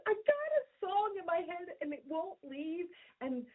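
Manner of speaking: high-pitched, urgent retold plea; worked-up protest. Wife on beach chair defending her songwriting to her husband, worked-up protest.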